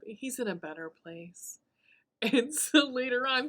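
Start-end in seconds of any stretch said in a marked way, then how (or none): 0.1-1.6 soft unvoiced deadpan whisper; mock solemn whisper. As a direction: whisper it like a fake condolence. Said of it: "She's like".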